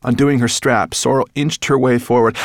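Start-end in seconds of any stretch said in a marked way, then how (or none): none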